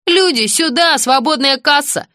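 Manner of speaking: high, slow mock cashier call. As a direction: call customers to a free till. Mock cashier calling customers.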